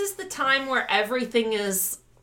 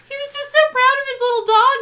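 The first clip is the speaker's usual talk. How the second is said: excited; 7 dB louder, faster than usual. Real, not acted. Gushing over Togo.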